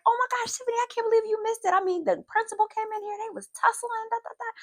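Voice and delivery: high-pitched imitation of a thrilled schoolfriend; excited gossip. Friend telling pupil about the fight, excited gossip. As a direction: spill the news in a squeaky, worked-up voice.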